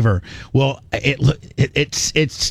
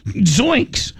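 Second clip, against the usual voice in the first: freaked out; 8 semitones higher, slower than usual. Retold or imitated. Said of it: "She's like".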